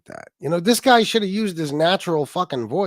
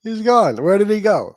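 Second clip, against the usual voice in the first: louder, higher feigned surprise; mock bewilderment; 5 semitones higher, 5 dB louder than usual. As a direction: act baffled at someone's sudden disappearance. Mocking the man's baffled reaction.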